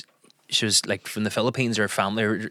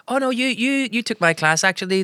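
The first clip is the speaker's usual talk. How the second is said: higher Belfast Filipino twang, lightly voiced; playful higher Belfast voice of a young server; Belfast Filipino girl; 12 semitones higher than usual. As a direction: imitate the KFC girl's Belfast lilt, pitched up. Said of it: "She's like".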